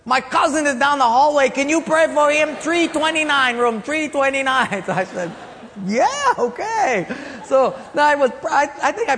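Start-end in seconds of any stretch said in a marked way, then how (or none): 0.1-4.8 high, loud, insistent calling voice; hammed-up pleading, pitched way up; eager requester. Patient asking pastor to pray for cousin, loudly insistent. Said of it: "he said". 5.8-7.1 very high, loud, drawn-out mock yes; bewildered agreement. Pastor agreeing to yet another prayer request, comically overwhelmed. Mimics own reply to growing requests.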